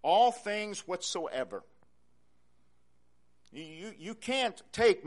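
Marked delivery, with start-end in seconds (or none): 0.0-1.6 "all things whatsoever" slow, each word weighed; stress on all things whatsoever. Shown as a demonstration. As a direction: lean on "all".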